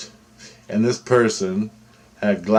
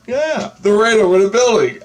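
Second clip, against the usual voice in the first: high, loud excited outburst; animated and emphatic, voice leaping high; excited retelling; 11 semitones higher, 5 dB louder than usual. Retold or imitated. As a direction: blurt it out, pitched way up. Animated retold report of ETs.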